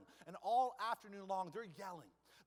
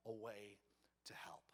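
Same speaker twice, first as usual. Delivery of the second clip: hushed close; 8 semitones lower, 7 dB quieter than usual. Acted. Preacher drops voice on key word.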